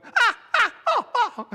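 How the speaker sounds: loud, very high mock shrieks; high staccato screams, hammed up; imitated panicked screams. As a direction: mimic a frightened woman's yelps. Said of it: "Japanese lady beside me going".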